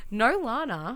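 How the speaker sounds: normal talking voice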